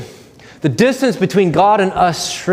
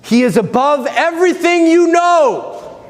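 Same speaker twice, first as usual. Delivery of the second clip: much higher, slow and emphatic; soaring proclamation; 12 semitones higher than usual. Real, not acted. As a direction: lift it up, proclaim God's height. Preacher rises in emphatic proclamation.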